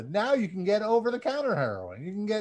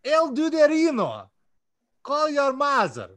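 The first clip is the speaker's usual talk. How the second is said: loud, higher hammed-up Russian accent; 5 semitones higher, 5 dB louder than usual. Reading out fan shoutouts on the podcast in a hammed-up Russian accent. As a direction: ham up a thick Russian accent. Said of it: "I'm gonna do it with a Russian accent for you".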